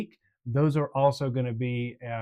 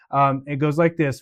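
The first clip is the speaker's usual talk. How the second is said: ordinary talk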